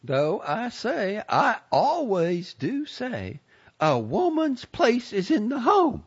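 put-on high, forceful old lady insistence; vehement. A narrator's reading. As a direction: declare it like gospel, slowly. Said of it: "she said this with such a vehemence".

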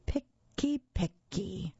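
Herbert fussing like a fussy monster; complaining monster. A narrator's reading. Monster Herbert grumbling at the picky kid, whiny. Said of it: "Herbert complained".

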